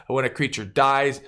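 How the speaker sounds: usual speaking voice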